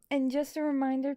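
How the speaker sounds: ordinary talk, nothing marked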